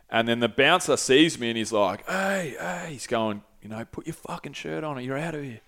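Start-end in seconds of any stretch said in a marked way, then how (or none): 2.1-2.9 soft, higher mock Portuguese accent; hushed take on a Brazilian bouncer. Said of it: "In Portuguese". 3.8-5.6 softly imitated Portuguese accent; bouncer's order in a held-back Portuguese accent; quiet mock-Brazilian bouncer. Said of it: "In Portuguese".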